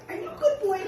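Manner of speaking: high squeal of praise for a pet bird; squealed pet talk. Real, not acted.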